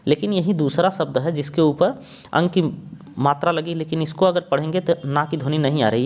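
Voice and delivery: ordinary talk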